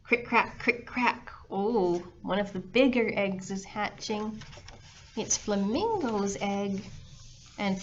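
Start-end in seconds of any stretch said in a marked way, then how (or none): none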